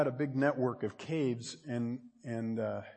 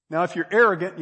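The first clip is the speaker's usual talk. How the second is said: usual speaking voice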